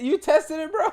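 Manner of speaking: can't believe what he's hearing; incredulous. Real, not acted. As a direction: friend reacting in shock to a stall story.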